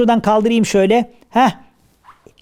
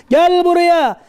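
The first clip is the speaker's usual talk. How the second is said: high, drawn-out call; chasing shout; 8 semitones higher, much slower than usual. Acted. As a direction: holler after someone running away. Kaçanı kovalarken bağırıyor. (kovalarken tiz sesle bağırma)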